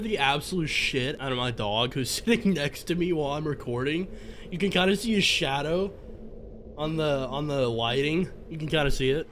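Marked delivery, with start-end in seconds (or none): none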